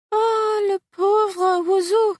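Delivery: high, loud cry of pity, slow on 'le pauvre oiseau'; French exclamation. Acted. Celeste exclaims over the featherless parrot, high and loud. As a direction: cry out in dismay over a bald bird, in French. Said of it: "exclaimed Celeste".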